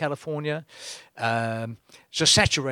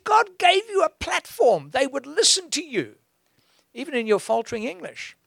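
pleading high voice; 9 semitones higher than usual. Retold or imitated. Preacher voicing his reply.

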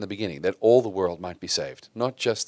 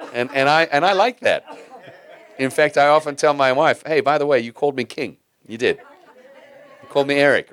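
higher and louder, laughing through the words; chuckling as he teases his wife; tickled and laughing about being called king; 5 semitones higher, 6 dB louder than usual. Real, not acted.

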